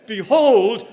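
Behold called out loud, falling at the end; Behold, declared to the congregation; proclaimed behold. Shown as a demonstration. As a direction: ring out Behold like a summons. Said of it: "Remember the words there".

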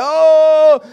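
high, loud imitation of a weeping cry; mock sobbing wail. Dad imitating his daughter crying during prayer, loud mock wail. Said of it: "my daughter sobbing and weeping and crying".

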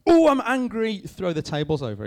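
loud, high, hammed-up Hulk-like outburst; mock rage. Preacher mimicking an impulsive angry Jesus, hammed-up rage. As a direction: burst out in exaggerated fury, then ease off. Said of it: "it's not like he's just gone".

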